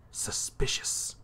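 slow, breathless whisper of suspicion. Acted. Alone in the collector's lair, whispering suspicion. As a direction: hush it under your breath, wary.

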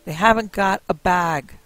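put-on old woman shouting back to her husband; shouted back. A narrator's reading. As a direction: shout it back over her shoulder, slowly. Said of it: "shouted back".

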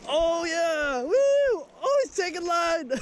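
high excited cheering; fighting a fish. Real, not acted. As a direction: whoop while reeling in a fish.

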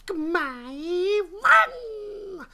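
high, loud, slow drawn-out title cry; mimicking game jingle. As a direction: copy the cutesy game announcer. Echoing the game's jingle.

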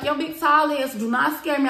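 loud, high-pitched taunt; retold yelling. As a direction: square up and yell it. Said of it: "I'm screaming".

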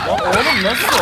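loud laughing outburst; laughing shout. Real, not acted. Surprised outburst at the game.

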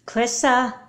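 loud call from another room. Acted. Mother calling her daughter from afar, loud. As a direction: call her name to find her.

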